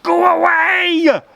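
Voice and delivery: high, loud, slow scream. A narrator's reading. Rabbit screaming at the storm wind to go away. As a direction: hurl it at the howling wind. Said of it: "he screamed to the wind".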